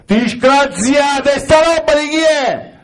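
very high, drawn-out scolding wife voice; mock wife scolding. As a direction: stretch the first word out like a shocked wife. Wife scolding about the clothes. (moglie che sgrida con voce acuta e strascicata)

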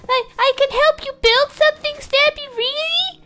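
put-on high, eager, wide-eyed kid voice; gee-whiz kid voice. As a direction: gush like an excited little kid. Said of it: "Only if you stop doing that voice".

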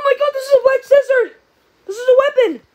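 high, loud exclamation; excited alarm. Real, not acted. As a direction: blurt out a startling find. Finds a weapon, alarmed.

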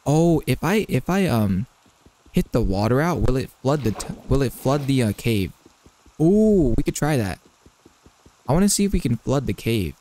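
ordinary talk

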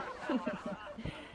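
quiet breathy laugh behind a hand; stifled giggle; soft chuckle. A narrator's reading. Boys hiding laughter at Courtney, soft stifled chuckle.

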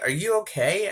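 are you serious, loud; incredulous. Retold or imitated. Asking the bodybuilder if he's okay, incredulous. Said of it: "I'm like".

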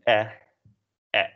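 short "eh" for Dutch E; clipped Dutch E vowel, isolated. Shown as a demonstration. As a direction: say the E as a brief "eh". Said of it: "in Dutch, it's pronounced as".

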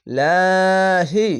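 slow tajweed chant; light lahi. Shown as a demonstration. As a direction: recite লাহি thin and drawn out. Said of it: "খাজা বলে এক আলিফ টেনে পড়বেন".

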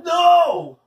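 long, high, loud shout; exasperated refusal. A narrator's reading. Leslie refuses Paul again, shouting in exasperation. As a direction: cut off the begging with a shout. Girl cuts off repeated begging.

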